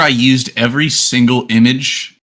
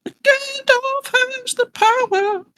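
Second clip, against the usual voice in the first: very high, drawn-out silly proclamation; slow falsetto chant; mock wizard proclamation; 4 dB quieter, much slower than usual. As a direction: stretch it out in a squeaky, high voice. Mock wizard chant for Gandalf joke.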